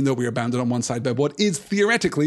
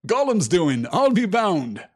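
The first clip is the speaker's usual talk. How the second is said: higher, louder knowing accusation; Sam's suspicion; 6 semitones higher, 4 dB louder, slower than usual. Shown as a demonstration. Reader rereading Sam's line, a touch higher. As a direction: repeat it with Sam's distrust. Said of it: "Sam is already suspicious".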